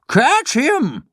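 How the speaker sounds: high, urgent call; crying out. Acted. Geppetto calling after the fleeing puppet, urgent cry. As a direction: shout for help to stop him. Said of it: "cried Geppetto".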